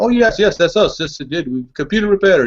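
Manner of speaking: high, eager mock French African accent; poor French African accent. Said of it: "Did they actually have a French African accent".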